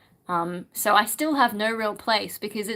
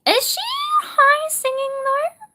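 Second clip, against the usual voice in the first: sudden much higher, incredulous; surprised question; 3 dB louder than usual. Real, not acted. Streamer to chat, surprised high-pitched doubt. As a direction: pitch shoots up in disbelief.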